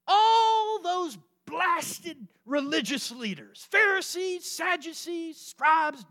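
loud, high denunciation, opening slowly; scornful preaching shout. Real, not acted. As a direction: call out each group with heated exasperation. Preacher scornfully listing religious leaders.

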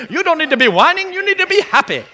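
put-on high, bright scolding voice with an accent; mock the friend correcting the caller; Jerry's retort. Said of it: "repeating Jerry's line".

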